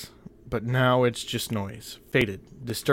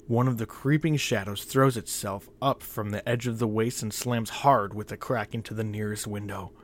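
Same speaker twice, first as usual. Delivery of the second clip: ordinary delivery, nothing marked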